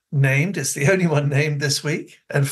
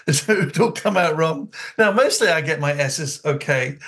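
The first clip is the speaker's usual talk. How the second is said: normal talking voice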